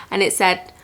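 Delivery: ordinary talk, nothing marked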